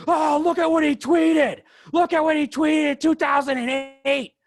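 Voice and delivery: loud, high, drawn-out mocking shriek; hammed-up scandalised outcry, stretched out; mock outrage. Podcaster imitating people who dig up old tweets, mock outrage.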